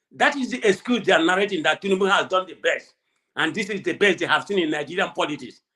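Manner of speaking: ordinary talk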